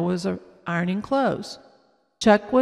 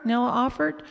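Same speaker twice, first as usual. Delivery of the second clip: usual speaking voice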